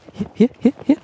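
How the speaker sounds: hoch und steigend rufen; Hund locken. Real, not acted. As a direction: den Hund hoch anlocken. Hund wird hoch gelockt. (hohes Locken zum Hund)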